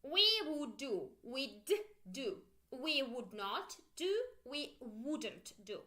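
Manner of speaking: slow "We would do", lips pushed forward on "We"; lip-rounded w. Shown as a demonstration. Teacher showing rounded lips for English w. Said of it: "pay attention on my lips".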